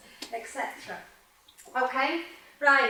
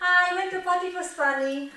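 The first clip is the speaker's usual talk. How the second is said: imitating a student's mistake, raised and louder; mock student; 6 semitones higher, 6 dB louder, faster than usual. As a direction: play the learner proudly getting it wrong. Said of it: "You go".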